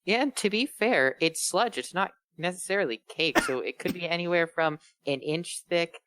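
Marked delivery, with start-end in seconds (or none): none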